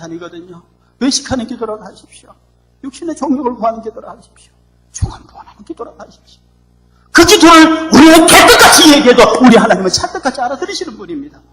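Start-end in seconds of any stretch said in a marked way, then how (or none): 4.9-6.4 fast, hushed whisper; whispered urging. Real, not acted. Preacher whispering to congregation to pray. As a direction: whisper the plea quickly.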